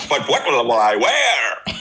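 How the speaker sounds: put-on Grinch, slow and dramatic. Co-host quoting the Grinch for a laugh, Grinch voice. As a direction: drawl it out like a fretting Grinch. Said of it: "Was that your Grinch voice?".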